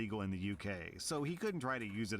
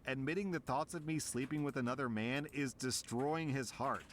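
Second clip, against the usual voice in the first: ordinary delivery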